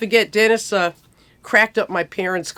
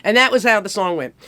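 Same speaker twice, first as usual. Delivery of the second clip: plain reading voice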